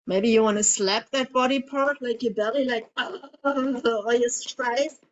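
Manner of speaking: ordinary talk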